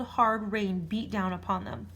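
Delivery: ordinary delivery, nothing marked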